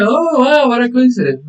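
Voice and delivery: high-pitched amazed reaction; real surprise. Real, not acted. Teacher to student about a shared name, surprised. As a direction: react high, struck by the coincidence.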